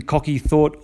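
plain reading voice

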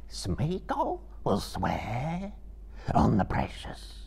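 slow, rising, eerie creature voice; drawn-out oath sinking into a rasp; Gollum. A narrator's reading. Gollum swears to Frodo, slow and eerie.

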